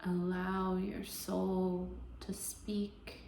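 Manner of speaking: slow, even meditation voice with long pauses; guided meditation. Acted. Yoga teacher easing listeners into Yoga Nidra, guided meditation voice. Leads a guided relaxation practice.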